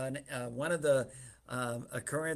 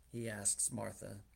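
normal talking voice

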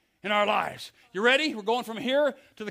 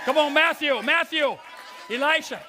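raised, higher summoning call; 5 semitones higher, 3 dB louder than usual. Real, not acted. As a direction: call them up from the crowd. Calling people up to join him.

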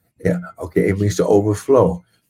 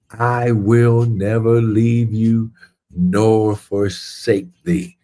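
slow scripture reading; much slower than usual. Acted.

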